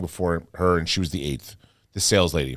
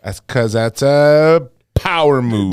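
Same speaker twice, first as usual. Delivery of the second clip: slow, loud promo growl; drawn-out hype declaration; wrestling promo; 3 semitones higher, 11 dB louder, much slower than usual. Pretend.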